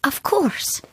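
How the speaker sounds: hushed and shaky; tearful whisper. Acted. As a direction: whisper it through tears. Whispered reply, close to tears.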